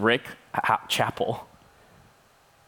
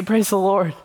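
mock cheer; 9 semitones higher, 5 dB louder than usual. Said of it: "And I say".

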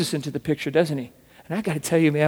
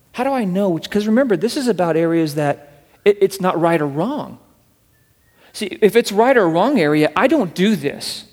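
louder, insistent preacher's push; emphatic preaching; 2 semitones higher, 6 dB louder than usual. Acted. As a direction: drive the point home to the church.

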